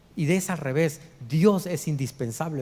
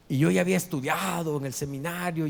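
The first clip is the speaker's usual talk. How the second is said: ordinary talk, nothing marked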